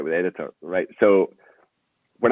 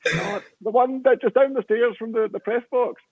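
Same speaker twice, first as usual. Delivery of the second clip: high, small imitated voice; answering helpfully from behind a locked door; wee trapped man; 13 semitones higher than usual. Quoting Jim from the toilets.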